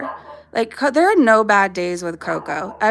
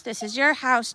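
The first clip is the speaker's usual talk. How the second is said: ordinary talk, nothing marked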